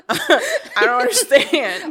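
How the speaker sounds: high, loud laugh through the words. Real, not acted. Laughing at the coworker's layoff question, high and loud. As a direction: crack up while saying it.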